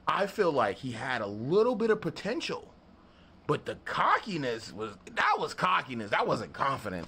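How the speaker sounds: ordinary delivery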